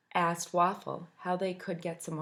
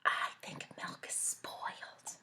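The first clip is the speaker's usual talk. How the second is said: slow secret whisper; 9 dB quieter, slower than usual. Acted. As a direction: breathe it to the friend beside. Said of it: "leaned over to Cupcake and whispered".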